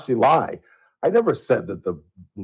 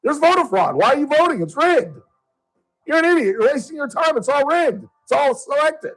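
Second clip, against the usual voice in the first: angry rant; 9 semitones higher than usual. Real, not acted. Radio host scolding a caller over voter fraud, angry. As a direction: tear into the caller.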